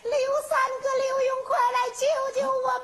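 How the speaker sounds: desperate high shouted plea; calling for help. Acted. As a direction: yell for help across the walls. Girls cry for help offstage.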